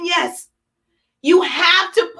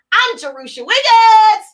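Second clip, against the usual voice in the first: big sign-off; 5 semitones higher, 5 dB louder than usual. Real, not acted. Host signing off the broadcast, loud exuberant announcement. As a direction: belt out your name to close the show.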